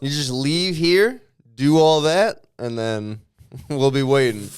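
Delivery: slow, loud instructions breaking into a laugh; mock helpful send-off. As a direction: spell it out loudly, then crack up.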